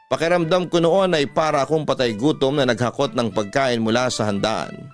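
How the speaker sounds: normal talking voice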